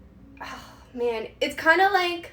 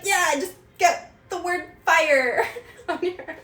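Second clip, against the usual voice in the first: slower, laughing through an impulsive idea; laughing at herself; 5 semitones higher, 4 dB louder than usual. Retold or imitated. Mocking her own random tattoo choices, laughing. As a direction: giggle while you suggest it. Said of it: "I wake up and I'm like".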